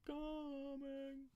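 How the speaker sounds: high, slow eerie singsong; high drawn-out spooky tease; creepy teasing voice. Pretend. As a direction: drag it out like a spooky threat. Creepy sung-like taunt, high far voice.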